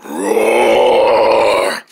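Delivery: a deep, full-voiced bear roar; the retake, a real rumbling bear roar; proper roar. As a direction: give the roar its full weight this time. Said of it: "let me do that again".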